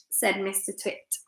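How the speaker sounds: plain reading voice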